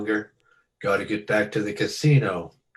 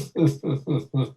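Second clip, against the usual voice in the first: short gangster chuckle; amused laugh; 5 semitones higher than usual. Acted. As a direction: laugh at the wisecrack.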